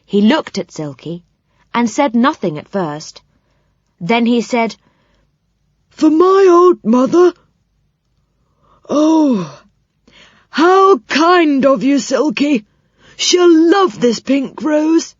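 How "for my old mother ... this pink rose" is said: put-on high, slow, tearful old man voice; a delighted old fellow near tears; old saucepan man moved by a gift; touched old saucepan man.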